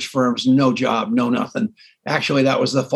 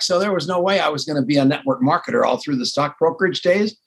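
usual speaking voice

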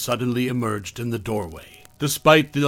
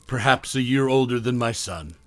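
ordinary delivery

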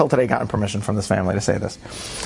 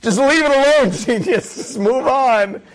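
exasperated guests; 11 semitones higher than usual. Retold or imitated. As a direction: beg him to drop it, half laughing. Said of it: "Everyone's looking at me ... being like".

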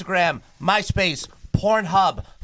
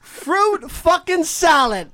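slow, higher, stretched-out announcement; drawn-out proud boast; 6 semitones higher, much slower than usual. Real, not acted. Boasting to friends about his fruit salad pick, slow and drawn out. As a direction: spell out each word like a trophy.

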